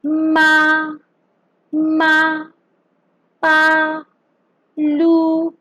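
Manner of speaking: MA, PA, LU each held high and level; long steady high note on "ma", "pa", "lu"; no rise or fall on high "ma, pa, lu"; first tone. Shown as a demonstration. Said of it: "First tone".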